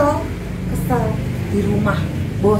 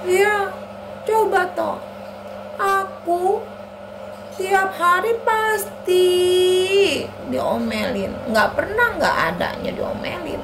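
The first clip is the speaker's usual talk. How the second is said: put-on high, aggrieved kid voice; complaining child Ani; 10 semitones higher than usual. Ani tells Anto of constant scolding, complaining. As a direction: draw out the grievance slowly.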